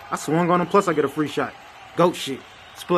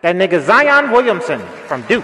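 booming draft announcer, louder; 4 semitones higher, 7 dB louder than usual. Acted. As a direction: call out the pick like a commissioner at the podium.

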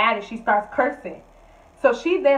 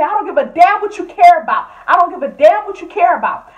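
higher, louder defiant shout; loud defiant retort; 7 semitones higher, 9 dB louder than usual. Retold or imitated. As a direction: yell it back at her.

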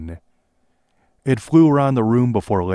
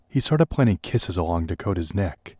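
ordinary delivery

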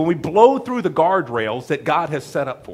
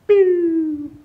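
falling whistle; 12 semitones higher, 4 dB louder than usual. Pretend. Sound of going off the cliff.

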